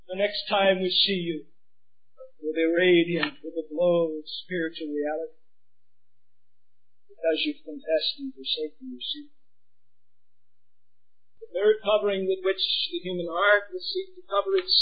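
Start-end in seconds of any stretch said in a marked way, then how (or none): 0.1-9.3 softer, gentle and reassuring; soft tender promise. Acted. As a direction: hold them close with a quiet hope. Tender promise after shouting.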